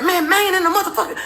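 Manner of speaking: high, quick, strained mock yell; retold urgent anger; angry husband. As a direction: imitate an irritated husband snapping. Retold yelling husband continues.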